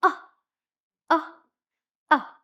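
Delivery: Ah as short u, pushed out hard then fading; short U sound, strained. Shown as a demonstration. Teacher acting out forcing the umbrella, strained short u. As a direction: strain on each Ah like pushing open an umbrella. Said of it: "push the umbrella".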